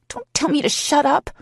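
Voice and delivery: high, loud shout; shouting back. A narrator's reading. Heroine shouting back at her lover. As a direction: fire it straight back at him. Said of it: "I shout back".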